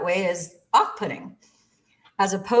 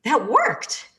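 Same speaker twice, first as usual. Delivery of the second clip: amazed; 4 semitones higher than usual. Retold or imitated. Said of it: "And I thought".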